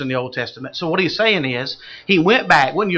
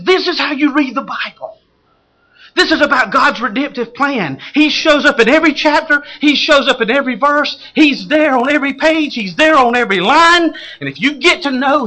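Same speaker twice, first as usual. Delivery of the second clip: louder, higher, punching every repeated phrase; preaching at full force; 8 semitones higher, 7 dB louder than usual. Real, not acted. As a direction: drive each line home to the congregation. Preacher's rising exhortation.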